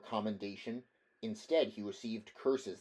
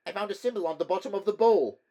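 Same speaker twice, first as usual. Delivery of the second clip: much higher, fast nervous chatter; frightened chatter; 10 semitones higher, 10 dB louder, faster than usual. Acted. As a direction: rattle it off in fear. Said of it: "chattered Primero".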